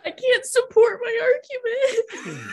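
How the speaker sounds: high, loud tearful wail; crying. Real, not acted. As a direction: wail it helplessly through tears. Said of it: "I'm crying because of it".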